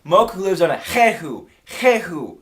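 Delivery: put-on emphatic Chinese-accented repeat; imitated Chinese owner. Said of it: "She says, she says".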